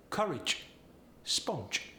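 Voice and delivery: "courage, sponge" ending in a CH sound; Polish-accent J as CH. Shown as a demonstration. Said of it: "J sound goes to the C H sound".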